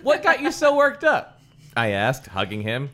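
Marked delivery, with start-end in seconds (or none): 0.0-1.4 high excited questioning; excited kid voice. Acted. Character voice reading dialogue.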